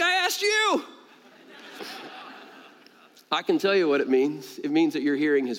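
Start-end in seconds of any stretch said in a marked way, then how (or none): 0.0-0.8 very high-pitched mock protest; retold indignant retort. Preacher retorts to the shirt wearer, mock indignant.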